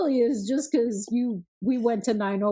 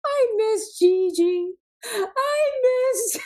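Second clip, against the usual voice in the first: higher, slow, whiny imitation of a sad kid; mock sad child; 12 semitones higher, 5 dB louder, slower than usual. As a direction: whimper it like a tired boy. Imitating son's sad bedtime.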